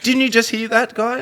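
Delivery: shrieking mock mum; exasperated mum. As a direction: mimic a disbelieving mother. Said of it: "My mum's like".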